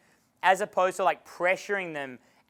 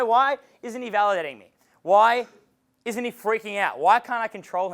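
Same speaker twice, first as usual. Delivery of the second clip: mock frustrated girl; 4 semitones higher, 5 dB louder than usual. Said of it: "the girl's gonna be like".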